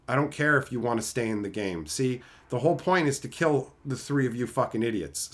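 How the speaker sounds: ordinary talk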